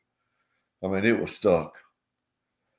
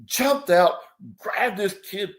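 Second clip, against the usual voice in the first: ordinary delivery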